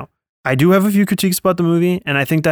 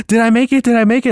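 higher-pitched frantic latecomer; mock urgency; 7 semitones higher than usual. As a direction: imitate someone anxiously running up. Latecomer runs up to line.

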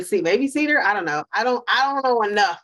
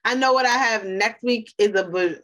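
normal talking voice

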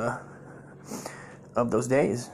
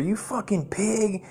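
drawn-out, high mock yell without real volume; retold angry yelling; 8 semitones higher, slower than usual. Relatives banging on the bathroom door, mock angry yell. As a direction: imitate relatives cursing through a door, pitched up and slow. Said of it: "be banging on the door".

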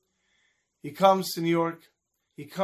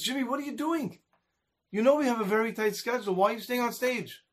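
exasperated manager; 6 semitones higher than usual. Retold or imitated. Manager scolds Jimmy offstage.

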